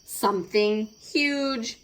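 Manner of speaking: plain reading voice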